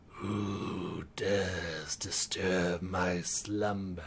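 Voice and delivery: slow, low, breathy monster whisper; grinding stone statue. Acted. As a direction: rumble it out from deep in a cave. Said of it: "and he says".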